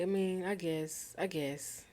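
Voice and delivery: ordinary talk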